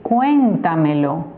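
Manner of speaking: CUÉNTAMELO slow, leaning on CUÉN; stress on first syllable. Shown as a demonstration. Teacher giving an example word to students, slow stress on CUÉN in CUÉNTAMELO. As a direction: say CUÉNTAMELO slowly as CUENtamelo. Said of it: "como por ejemplo cuéntamelo".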